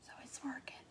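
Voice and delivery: whispered to herself, no voice at all. Real, not acted. Crafter admiring her wreath, whispering to herself. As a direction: breathe it out as a private aside.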